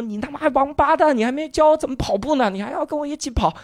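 high, grieving shout; anguished shout. Retold or imitated. Mourner shouting over the friend's body, high anguished yell. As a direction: yell at the dead friend in grief. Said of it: "然后他在那喊说".

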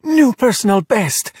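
high, slow triumphant cheer. Acted. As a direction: cheer it out like a victory.

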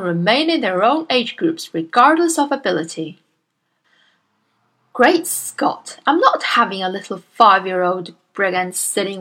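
loud, booming bully of a headmistress; Miss Trunchbull. Acted. Bullying headmistress scolds Miss Honey, booming and loud. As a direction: bark at the timid teacher like a tyrant.

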